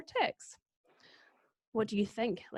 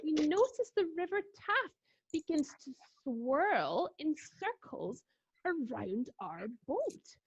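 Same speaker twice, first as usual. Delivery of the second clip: ordinary talk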